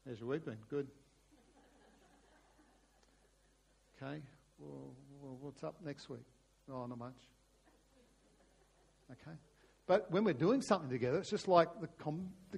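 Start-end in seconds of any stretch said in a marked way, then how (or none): 0.1-9.4 soft, low, halting mock conversation; awkward small talk. Father and son over coffee, awkward small talk. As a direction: act out stilted chat with long pauses. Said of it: "face to face or trying to have a coffee".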